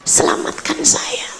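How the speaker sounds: soft, whispered plea; retold whispered prayer. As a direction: beg God under your breath, nearly voiceless. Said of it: "Saya kemudian mulai berdoa". (doa memohon sambil berbisik)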